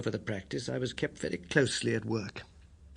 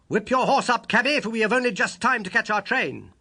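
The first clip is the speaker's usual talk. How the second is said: higher and louder, a brisk command; calling to the cabby; 9 semitones higher, 5 dB louder than usual. A narrator's reading. Holmes shouting up to the cabby to hurry, higher and loud. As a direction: call up to the driver to hurry.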